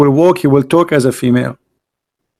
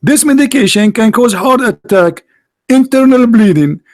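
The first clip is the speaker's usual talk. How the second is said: mock warning label; 7 semitones higher than usual. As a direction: read the side effects like a sarcastic alarm. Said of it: "the sticker says".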